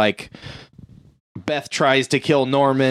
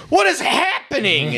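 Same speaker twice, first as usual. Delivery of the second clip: much higher, freaked-out question; bewildered cry. Retold or imitated. Crew lost in the looping ship, high and bewildered. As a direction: squeak it out in total bewilderment. Crew seeing the cockpit again.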